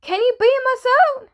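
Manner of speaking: high, loud, fast plea; urgent yelled plea. A narrator's reading. Tommy begs to be beamed out, yelling urgently. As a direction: yell it urgently, pitch way up.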